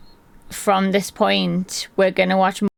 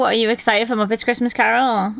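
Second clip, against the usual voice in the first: ordinary talk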